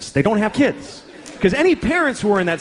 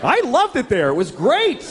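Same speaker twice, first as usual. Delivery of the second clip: put-on much higher, giddy kid delight; childlike gushing; 9 semitones higher than usual. Kid remembering camp, high giddy delight. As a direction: gush like a thrilled kid.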